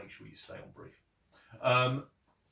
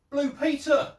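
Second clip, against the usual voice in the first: excited title call; 10 dB louder than usual. Acted. Announcing a show title.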